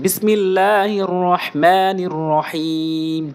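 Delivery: slow, drawn-out Quranic recitation. Real, not acted. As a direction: intone it slowly, stretching the long vowels. Recites the opening formula.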